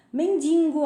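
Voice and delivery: "Mendigo" said as "mendingo" with an added n; nasal n slipped into "Mendigo"; wrong pronunciation. Shown as a demonstration. Teacher showing the common mistake, mispronounced. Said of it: "Mendigo ao invés de mendigo".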